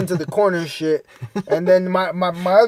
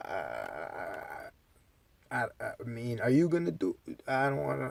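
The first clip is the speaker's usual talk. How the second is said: lower, softer, halting stammer; stammering nervous Pedro; 7 semitones lower, 15 dB quieter than usual. Retold or imitated. Pedro balking at the drug, nervous soft stammer. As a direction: hesitate like you're scared to try.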